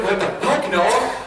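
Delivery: high, incredulous mock protest; reenacted appalled reaction; shocked outburst. Quoting own shocked reaction to friend.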